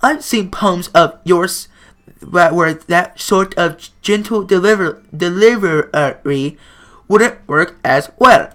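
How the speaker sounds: measured, careful advice; slow gentle club president. Acted. Monika explains slowly.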